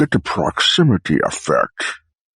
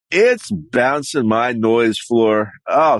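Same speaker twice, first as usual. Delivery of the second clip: ordinary talk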